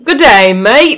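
put-on playful Australian accent, lifted pitch. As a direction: belt out a mock-Australian "g'day, mate". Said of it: "Actually, it was pretty good".

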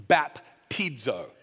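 baptizo drawn out slowly as bap-TEED-zo; Greek pronunciation. Shown as a demonstration. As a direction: say baptizo like a slow audio pronunciation guide. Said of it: "That's how you say it".

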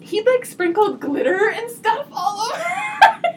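high, loud and laughing with delight; thrilled kids. Retold or imitated. Woman reliving finding Santa's hay as a kid, high excited gushing. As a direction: gush like a child on Christmas morning.

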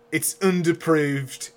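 put-on slow, deliberate Paul Hollywood-style British accent. Co-host imitating a hilarious TV baking judge, deathly judgment in a British accent. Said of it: "Have you been practicing that accent?".

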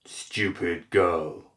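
very slow, altered demon voice; monstrous possessing voice. A narrator's reading. Demon speaking through the possessed imp to Asami, monstrous and slow. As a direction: draw it out as an oppressive demon. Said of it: "the voice was not his own".

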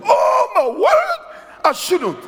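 high, slow, laughing shout of surprise; laughing exclamation. Retold or imitated. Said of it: "And I'm pulling and I go".

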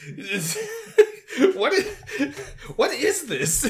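high laughing disbelief. Real, not acted.

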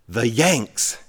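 punchline in an understated mom voice; mom's punchline. Retold or imitated. Mom tells her son the joke's answer, lightly voiced punchline. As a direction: deliver the punchline at an ordinary pitch, lightly imitated.

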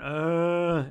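long, held zombie "uh"; drawn-out sung zombie drone; zombie groan. Retold or imitated. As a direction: imitate a zombie moaning along to a song. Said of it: "singing with one of the zombies. He's going".

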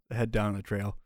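normal talking voice

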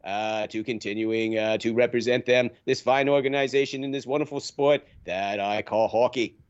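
put-on Boston-accented politician fielding press questions. Players' association director addressing reporters, Boston accent. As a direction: do a speechifying official with a Boston accent. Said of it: "you have the best Boston accent".

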